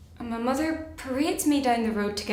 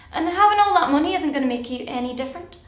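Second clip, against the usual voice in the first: raised, higher-pitched telling-off; scolding; 6 semitones higher, 7 dB louder, faster than usual. Acted. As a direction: berate him loudly about his big plans. Berating Arthur over his big plans.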